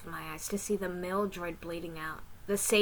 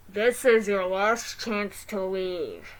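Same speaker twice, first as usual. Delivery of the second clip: very slow, loud robotic warning; 10 dB louder, much slower than usual. Acted. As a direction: warn slowly like a machine. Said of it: "the robot said".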